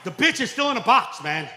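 fed-up dad punchline; exasperated. Real, not acted. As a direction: blurt it in comic frustration.

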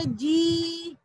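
जी with a drawn-out ee; long vowel. Shown as a demonstration. Teacher recalling how they read it, long-held जी. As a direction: hold the vowel in जी. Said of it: "बड़ी की मात्रा जोड़ी थी".

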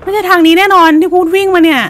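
loud frantic cry on the run; panicked shouting. Real, not acted. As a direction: yell it in blind panic. Still fleeing in panic. (ตะโกนตื่นกลัว)

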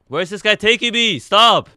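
high, loud panicked yell; alarmed shout. Real, not acted.

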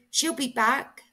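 a little owl reassuring the others, high; hopeful baby owl. Acted. Sarah tells her brothers their mother will return, high and hopeful. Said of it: "said Sarah".

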